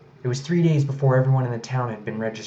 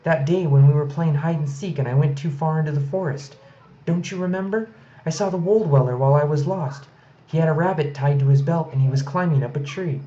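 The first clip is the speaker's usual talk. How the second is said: put-on higher, louder child insisting; little girl's voice; 5 semitones higher, 5 dB louder than usual. A narrator's reading. Medley reminding her father she saw the creature, insisting. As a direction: plead your case like a small girl.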